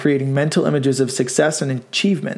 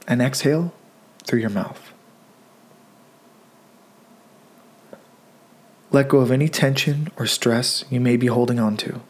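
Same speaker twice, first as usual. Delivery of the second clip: guided meditation. Acted. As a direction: gently guide listeners into stillness, leaving room to breathe.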